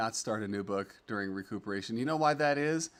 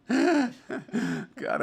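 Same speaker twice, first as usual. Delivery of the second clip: high burst of laughter; laughing; 11 semitones higher, 5 dB louder than usual. Real, not acted. Streamer laughing at a kitten named Meatball. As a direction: crack up at the name.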